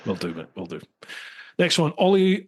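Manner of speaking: ordinary talk, nothing marked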